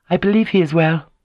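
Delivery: lightly bright courtesy over hidden anger; polite and carefree. A narrator's reading. Lady fencing with Kyoko, polite and carefree. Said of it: "their voices polite and carefree".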